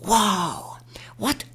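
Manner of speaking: breathless whispered wow, no voice. Shown as a demonstration. Teacher demoing a whispered wow with dropped throat. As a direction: breathe out the word on air alone. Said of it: "and we go".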